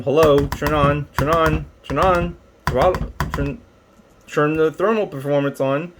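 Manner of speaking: half-serious nagging at a machine; mock impatient urging. Impatiently pleading with an unresponsive gadget.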